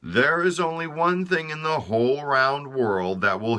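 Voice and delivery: a hint of a solemn doctor delivering bad news; grave physician. Physicians speak to the king.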